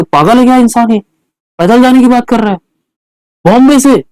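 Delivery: high-pitched, disbelieving acted retort; mock incredulous reaction. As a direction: act out someone calling the idea crazy, pitched well up. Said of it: "बोलेंगे". (ऊँची आवाज़ में हैरानी का अभिनय)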